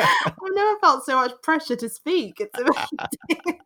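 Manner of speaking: high, loud laughing protest; amused squeal under pressure; flustered laughing. Real, not acted. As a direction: laugh through it, put on the spot. Put on the spot, amused.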